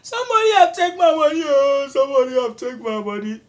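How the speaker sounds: high, loud frantic wailing cry; shrill alarm about stolen money; panicked shout. Retold or imitated. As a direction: yell it out like the money just vanished.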